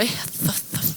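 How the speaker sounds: spluttered, voiceless lisping hisses; lisping Sylvester imitation. Speaker mocks how she talked with the mouth hole, lisping splutter. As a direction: mimic a cartoon lisp in short hissy bursts. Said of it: "hablaba como el silvestre".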